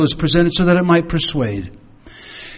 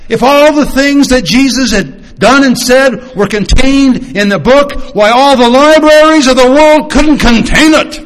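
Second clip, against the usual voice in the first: rising higher and louder to a high, loud peak; building excitement; 10 semitones higher, 6 dB louder than usual. Acted. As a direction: build it up to a triumphant climax. Preaching the end of John.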